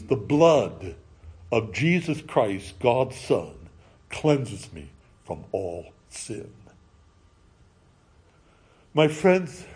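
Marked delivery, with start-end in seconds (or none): 0.0-6.5 lower, soft, slow solemn reading; solemn scripture. Acted. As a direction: recite it as sacred words. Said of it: "write on each of these, these words".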